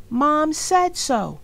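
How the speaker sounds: put-on high, loud kid voice insisting; a stubborn little girl; high insistent little sister. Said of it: "said D W".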